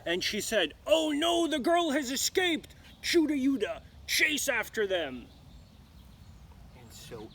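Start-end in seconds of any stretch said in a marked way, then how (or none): 0.9-5.3 loud, much higher furious shout; furious witch. Acted. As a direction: scream orders like an enraged witch. Witch discovers escape.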